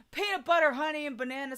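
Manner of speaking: high, quick gasped "peanut butter"; sudden delighted gasp; excited gasp. Real, not acted. Excited food reaction.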